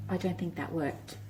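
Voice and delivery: quiet aside. Acted. Aside to children, dropped voice.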